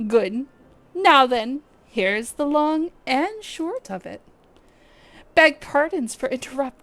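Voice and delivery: lightly voiced formal officer; voiced game character. Streamer voicing a quest-giver's briefing, lightly formal. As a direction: read the quest-giver's lines with stately courtesy. Reading NPC dialogue aloud.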